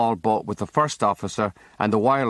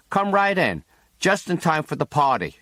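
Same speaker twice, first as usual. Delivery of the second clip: higher, bright American welcome; American hostess; 6 semitones higher than usual. A narrator's reading. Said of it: "That's the way they talk".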